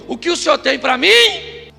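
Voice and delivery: loud, slow prayer cry; fervent prayer. Acted. Worshipper crying out to God in prayer. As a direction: lift it up to God.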